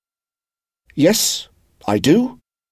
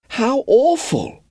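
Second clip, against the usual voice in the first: high, slow, drawn-out dismay; shocked sympathy; slower than usual. Acted. Wendy sorry for Peter, slow and high dismay. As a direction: gasp it out with sorrow for him. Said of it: "she said".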